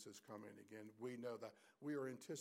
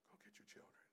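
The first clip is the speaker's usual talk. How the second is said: quiet, breath-held command from Father to Son; hushed voice of God; 9 semitones higher, 14 dB quieter than usual. Acted. Preacher voicing God sending his Son for his children, hushed. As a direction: drop to a near-whisper as God.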